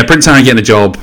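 ordinary talk, nothing marked

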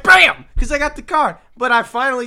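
high, loud bragging shout; mock triumphant yell. Bill Burr bit, loud bragging shout. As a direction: shout it like slamming down a card. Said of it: "And I'm like".

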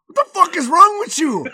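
loud, high-pitched scolding; mock outraged mom. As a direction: imitate a mother blowing up at her child. Mom scolds child in retold row.

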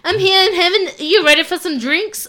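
dad copying his own dad, the Pennsylvania grandfather; Pop Pop accent. Retold or imitated. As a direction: boom it out like jolly old Pop Pop. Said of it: "my dad would just be like".

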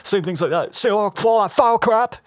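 high, fast, worked-up Rambo rant; Stallone impression. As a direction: rant like a broken veteran. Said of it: "That's spot on".

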